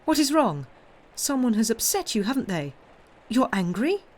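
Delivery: high, urgent granddaughter's questions; anxious questioning. A narrator's reading.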